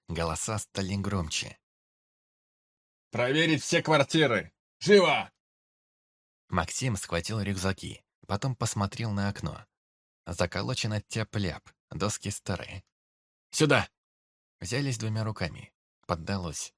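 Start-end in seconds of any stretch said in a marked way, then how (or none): 3.1-5.3 shouted order. Acted. Soldiers ordering a search. 13.5-13.9 urgent shout. Acted. Urgent escape through window.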